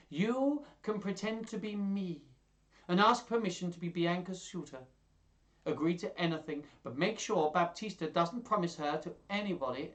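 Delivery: excited character voice laying out a scheme; Lucentio, eager schemer. Acted. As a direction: pitch it up as a young master hatching a plan.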